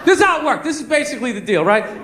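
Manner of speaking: ordinary talk, nothing marked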